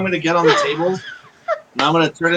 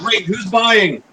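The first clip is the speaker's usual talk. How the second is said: mild, raised-pitch yell; quick yell; 5 semitones higher, much slower than usual. Acted. Player calling out in the bar, mild yell. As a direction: call it across the bar, a bit higher. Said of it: "I yelled real quick".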